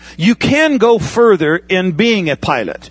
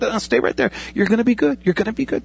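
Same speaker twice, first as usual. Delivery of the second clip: softer, steadying coach voice; calm the nervous student down gently; reassuring trainer; 4 semitones higher, 5 dB quieter, faster than usual. Pretend. Flight trainer beside the student in the right seat: soft reassurance. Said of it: "he'll come alongside you and it's like".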